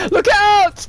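high, loud drawn-out yell; warning shout. Real, not acted. Player warning during a snowball fight, urgent shout.

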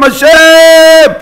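very high, loud, long-held shout; crying out to God. Acted. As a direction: scream the Name out to heaven. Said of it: "היו צועקים". (זעקה ממושכת לשמיים)

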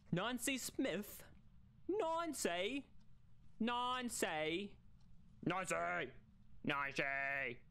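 slow, put-on foreign accent; Nancy said as Nonsay in a hammed-up accent; mock accent. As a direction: stretch the name in a funny accent. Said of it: "What's an accent I could say?".

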